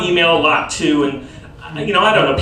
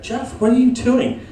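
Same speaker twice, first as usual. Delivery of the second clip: wife scolding; 6 semitones higher than usual. Retold or imitated. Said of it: "she's like".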